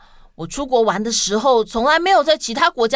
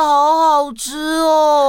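savoring; 4 semitones higher, 4 dB louder, much slower than usual. Acted. Savouring the treat.

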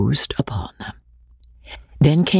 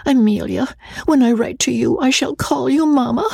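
wistful; 12 semitones higher than usual. Acted.

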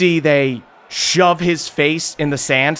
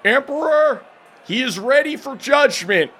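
annoying authoritative voice; 13 semitones higher than usual. Acted. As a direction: read the charges like a pompous official. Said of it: "the dragoon looks up to the Emperor and goes".

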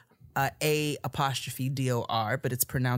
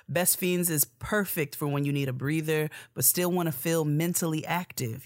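ordinary delivery

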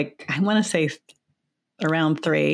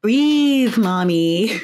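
put-on high, small toddler voice, louder on breathe; little child voice; 4 semitones higher, 4 dB louder, much slower than usual. Toddler in car seat calming her ranting mother, little high child voice. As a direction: imitate a toddler gently telling her mom. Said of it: "This little voice from back in the car seat".

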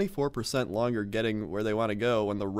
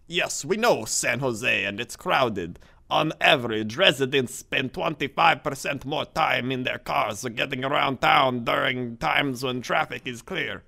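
slow, foreign-sounding mock accent reading; put-on Strong Bad accent; 3 semitones higher than usual. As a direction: read it in a goofy cartoon accent. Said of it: "what accent is that?".

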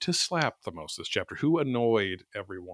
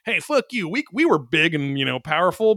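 mocking Corcus; 6 semitones higher than usual. Corcus snapping back at the band, mock high defiance. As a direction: sneer it back as Corcus would. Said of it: "Corcus is like".

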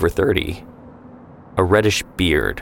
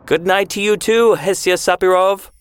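high, cheerful call after her as she leaves; lightly voiced man calling out teasingly; 12 semitones higher than usual. A narrator's reading. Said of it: "his voice trailing after her".

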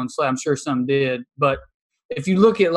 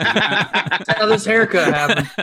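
slow, higher joking line with laughter; laughing, slow; 5 semitones higher, 3 dB louder, slower than usual. Real, not acted. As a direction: drop the self-mocking joke while laughing. Joke about own haircut, laughing.

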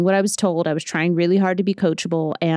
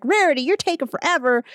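higher, impatient cartoon pony voice; Rainbow Dash; 9 semitones higher than usual. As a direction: hurry her along in a pitched-up cartoon voice. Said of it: "And Rainbow Dash is like".

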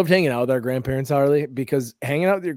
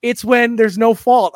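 high, loud, drawn-out mock grandma; 9 semitones higher, 7 dB louder, slower than usual. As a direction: imitate grandma explaining slowly and insistently. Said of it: "She goes".

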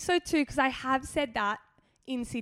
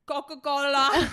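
lips held apart, louder; no lip closure, words mushed; lips not touching; 3 semitones higher, 5 dB louder than usual. Shown as a demonstration. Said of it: "everyone else goes like this".

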